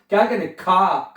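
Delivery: ordinary talk, nothing marked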